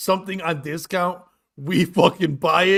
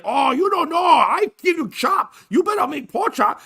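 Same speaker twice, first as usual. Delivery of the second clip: mock accent, jokingly called Irish; 10 semitones higher than usual. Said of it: "it's my Irish voice".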